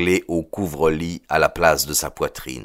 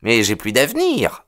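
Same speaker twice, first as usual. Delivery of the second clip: high-pitched, pushy suitor's voice; high, insistent young man; eager young suitor; 7 semitones higher than usual. A narrator's reading. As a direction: pitch it up high, pleading a case.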